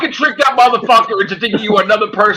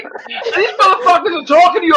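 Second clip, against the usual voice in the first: normal talking voice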